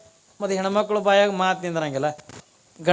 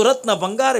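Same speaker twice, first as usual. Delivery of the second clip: ordinary talk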